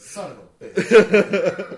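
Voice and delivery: drawn-out exasperated curse; loud exclamation. Real, not acted. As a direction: groan it out, loud and slow. Reacting to the new rule.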